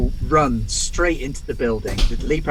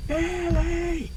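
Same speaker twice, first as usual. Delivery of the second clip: high, drawn-out call of two names; shouting; much slower than usual. Acted. Player's character runs into the building calling for friends, shouting. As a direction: call out for people somewhere in the building, voice pitched way up. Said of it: "and start shouting".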